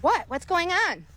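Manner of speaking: put-on high-pitched mouthy kid; attitude. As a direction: imitate a kid giving attitude, pitch jumping up. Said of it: "this kid got an attitude".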